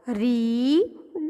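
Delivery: very slow રી, pause, then ડ; blended reading. Shown as a demonstration. Teacher leading children in reading the spelling, slowly sounded out. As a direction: draw out રી, then add a separate ડ. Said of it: "ચાલો, spelling વાંચો".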